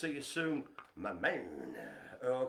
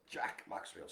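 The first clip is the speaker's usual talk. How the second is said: whisper; 7 semitones lower, 6 dB quieter than usual. Shown as a demonstration. Name repeated hushed.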